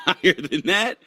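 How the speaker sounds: normal talking voice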